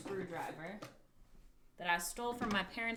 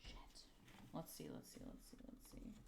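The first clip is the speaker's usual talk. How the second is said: muttered to self; 4 semitones lower, 14 dB quieter, faster than usual. Real, not acted. Concentrating on the clock.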